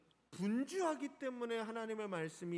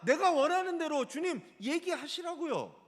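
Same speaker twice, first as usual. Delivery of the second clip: louder and higher, insisting on her own way; peeved demand, loud and pitched up; demanding, indignant; 7 semitones higher, 8 dB louder than usual. Acted. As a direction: tell the Lord what to say, huffy. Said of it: "라고 말하는 거예요".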